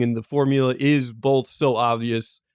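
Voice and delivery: usual speaking voice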